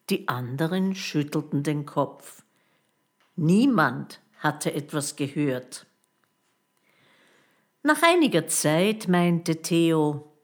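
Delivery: plain reading voice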